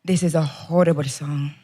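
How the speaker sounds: Salvadoran grandma, lightly voiced indignation; Salvadoran grandma accent. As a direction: imitate an offended Salvadoran grandmother. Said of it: "my grandmother was like".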